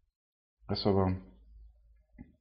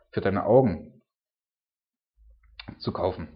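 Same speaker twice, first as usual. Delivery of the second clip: preaching scripture. Acted. Preacher reading God's rebuke to the church, solemn and emphatic. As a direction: proclaim it like a sermon text, building weight.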